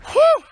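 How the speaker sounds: high, loud relieved whew. Real, not acted. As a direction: blow out a big whew. Winded after walking, exclaims.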